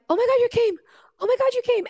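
gasping at a miracle you begged for; overjoyed disbelief. Retold or imitated. To Jesus at her bedside, high overjoyed disbelief.

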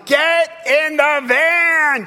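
long, drawn-out high yell. Retold or imitated. Late dad to his kids from the van, yelling. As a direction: holler it out the van window, stretching the words. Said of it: "I'm yelling".

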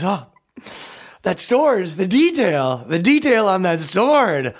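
hammed-up weepy marvelling; mock crying. Mocking a man who cries at animation work, fake tearful wonder. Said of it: "He cries at the animation work".

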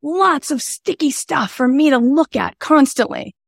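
high, gleeful put-on voice; high delighted mock voice; brain as eager character. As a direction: play the brain relishing a problem. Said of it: "Your brain's like".

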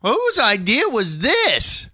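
high, slow indignant question. Real, not acted. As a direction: demand it, high and drawn out. Indignant over two-hour show.

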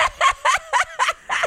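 high-pitched giggle; high laugh. Real, not acted. Laughing at kid impression.

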